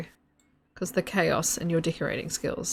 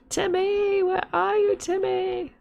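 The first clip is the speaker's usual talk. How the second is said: high, loud drawn-out call; 13 semitones higher, 5 dB louder, slower than usual. Pretend. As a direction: sing out for someone lost. Calling out for Timmy.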